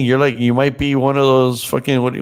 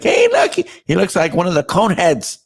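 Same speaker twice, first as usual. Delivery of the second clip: put-on much higher, a teasing little kid; little girl voice; 8 semitones higher than usual. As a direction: voice a little girl pointing something out. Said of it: "they were like".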